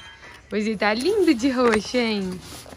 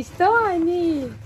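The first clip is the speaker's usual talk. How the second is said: calling the horse; 7 semitones higher than usual. Real, not acted.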